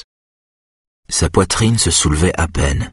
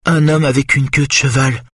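higher, frightened moan; 9 semitones higher than usual. A narrator's reading. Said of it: "gémit-elle".

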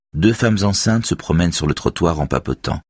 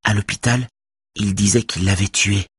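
put-on whispering girl; 4 semitones higher than usual. A narrator's reading. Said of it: "chuchote Beverly".

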